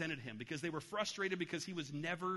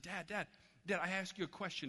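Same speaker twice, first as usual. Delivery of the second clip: put-on insistent little boy; 4 semitones higher than usual. Boy voiced in a story.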